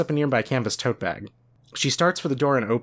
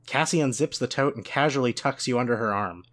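normal talking voice

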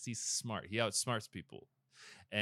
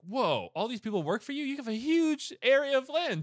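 high-pitched impressed wonder; acted, rising amazement; amazed king; 11 semitones higher than usual. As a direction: marvel at it like a wowed king.

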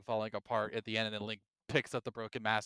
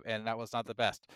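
usual speaking voice

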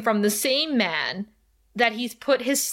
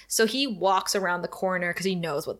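normal talking voice

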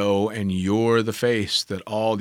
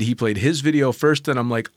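ordinary talk

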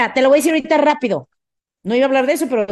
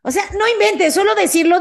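higher pitched disbelief; incredulous outburst; exasperated disbelief; 7 semitones higher than usual. Real, not acted. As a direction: react as if it's painful to hear. Reacting to bad example.

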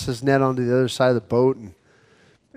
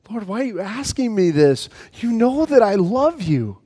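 hurt plea; 5 semitones higher, 4 dB quieter than usual. Acted. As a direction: let the hurt rise as he pleads. Peter hurt by the third question.

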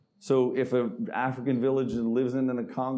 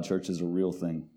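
ordinary delivery

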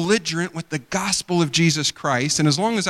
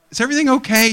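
concerned; 8 semitones higher, 5 dB louder, much faster than usual. Retold or imitated. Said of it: "I'm like".

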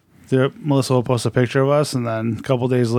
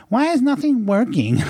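high-pitched complaining mom impression; exasperated mom; 11 semitones higher than usual. Son mimics his mom stuck without data, exasperated. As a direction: whine it high, like a baffled mother. Retelling mom baffled by phone.

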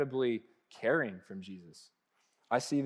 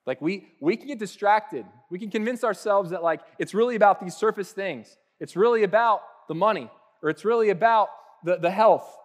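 higher, louder building sermon delivery; rising, insistent preacher voice; preaching; 6 semitones higher, 6 dB louder than usual. Acted. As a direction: press the point home to the congregation.

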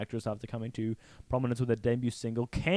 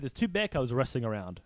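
ordinary talk

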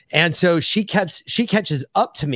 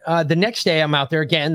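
ordinary talk, nothing marked

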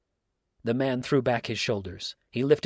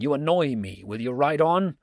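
annoyed, raised-voice demand; exasperated cry; 4 semitones higher, 6 dB louder than usual. A narrator's reading. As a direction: urge him off with mounting impatience. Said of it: "cried the man".

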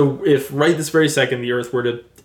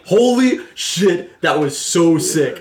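higher, slow awed exclamation; amazed reaction; 5 semitones higher, much slower than usual. Retold or imitated. Viewer reacting to the Mars cut, awed drawn-out exclamation. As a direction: drag it out in stunned wonder. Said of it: "I was like".

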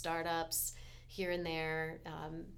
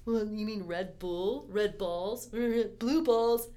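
mocking clueless people; 4 semitones higher, 10 dB louder, slower than usual. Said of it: "I get people who are like".

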